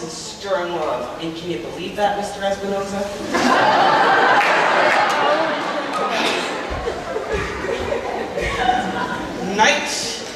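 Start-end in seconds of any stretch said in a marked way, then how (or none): 3.0-9.5 long breathy impish cackle, rising then falling; mischievous laugh. Acted. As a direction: laugh like a sprite delighted with his trick. Puck gloats over sleeping lovers.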